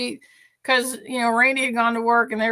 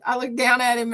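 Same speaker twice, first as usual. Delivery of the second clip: ordinary talk, nothing marked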